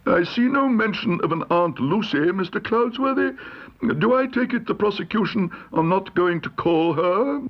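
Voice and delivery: high-pitched querulous judge; pompous judge. A narrator's reading. Judge questions Mister Cloudsworthy about Aunt Lucy, pompous. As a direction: question counsel with judicial pomposity.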